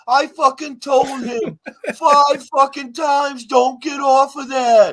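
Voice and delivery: high, slow, loud shout; mimicking a friend yelling in frustration; retold angry yelling. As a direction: yell it like a furious wife who warned him. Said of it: "And Sam starts yelling at Tim".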